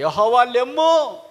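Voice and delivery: high, drawn-out shout to God; loud invocation. Acted. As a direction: summon the Lord with a ringing call. Calls on God to arise.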